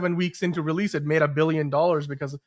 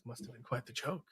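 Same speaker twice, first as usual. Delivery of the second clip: much softer deep-voiced attempt at Liam Neeson; 3 semitones lower, 18 dB quieter than usual. Attempting the Liam Neeson line for co-host, softer and deep. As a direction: drop it low like Liam Neeson, barely voiced. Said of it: "That in his deep voice".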